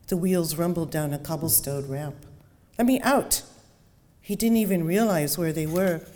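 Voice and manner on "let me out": loud, high cry to be freed; panicked shout. Acted. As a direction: bang on the glass and yell. Trapped passenger yells.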